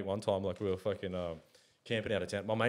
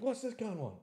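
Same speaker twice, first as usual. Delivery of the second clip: annoyed driver; 13 semitones higher, 4 dB quieter than usual. Retold or imitated.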